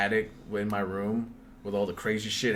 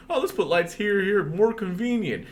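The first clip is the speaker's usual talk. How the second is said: high, louder mocking voice of the old builders; 12 semitones higher, 7 dB louder than usual. Mocking how the builders wired the old house, high and laughing.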